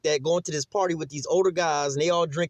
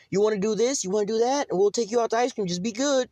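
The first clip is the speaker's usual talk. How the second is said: higher, coaxing mom imitation; mock negotiating mom; 8 semitones higher than usual. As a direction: coax the kids like a pushover mother, pitched higher. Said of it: "they be negotiating".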